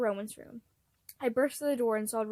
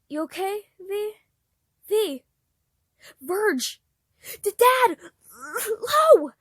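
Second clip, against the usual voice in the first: louder, higher, frantic calls that break into a cry; panicked calling out; 8 semitones higher, 10 dB louder, much slower than usual. Acted. Child calling Dad and Lo after finding his brother collapsed, panicked shouts. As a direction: yell for help, terrified.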